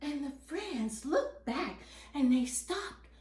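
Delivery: plain reading voice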